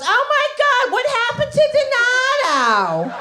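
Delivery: high mock-shocked cry of "Oh my God"; a loud Jersey girl stunned; Jersey friend shock. Girlfriend Anna asks the bald date what happened, high shocked cry. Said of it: "my girlfriend sits right next to him and goes".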